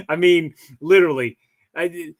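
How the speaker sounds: ordinary delivery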